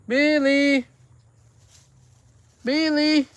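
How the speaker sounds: high, loud pet call. Real, not acted. Owner summons the cat Billy, calling out. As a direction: call the cat from across the yard.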